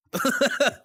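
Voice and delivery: high, loud sudden laugh; weird loud laugh. Real, not acted. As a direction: burst into an odd high laugh. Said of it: "I laugh a little weirdly, like, and loud".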